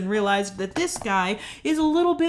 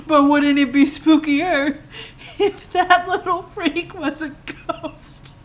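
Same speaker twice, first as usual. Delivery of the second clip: high, slow, loud, breaking into laughter; gleeful climax; 8 semitones higher, 6 dB louder, slower than usual. Real, not acted. Storyteller revealing the ghost twist, gleeful climax. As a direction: build the absurd reveal slowly and loudly.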